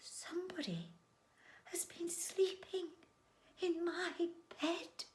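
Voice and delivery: soft, high, half-whispered voice; hushed high mother-bear voice; quiet, gentle Mother Bear. Acted. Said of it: "in a quiet, gentle voice".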